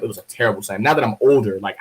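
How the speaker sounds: normal talking voice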